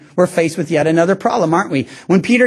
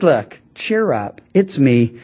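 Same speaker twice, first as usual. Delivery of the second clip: gentle reassurance; 9 semitones lower, slower than usual. Retold or imitated. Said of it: "he just says".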